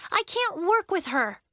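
high-pitched, exasperated protest; Willa crying out. A narrator's reading. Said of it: "cried Willa".

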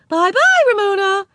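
very high, loud cooing bye-bye; cooing goodbye. A narrator's reading. Mrs Whistler cooing goodbye to little Ramona, very high. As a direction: coo it to a small child.

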